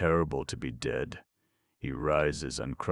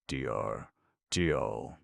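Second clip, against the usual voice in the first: ordinary delivery, nothing marked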